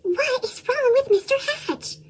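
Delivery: hushed, breathy whisper. A narrator's reading. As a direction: whisper it like a worried neighbour. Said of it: "Everyone whispered".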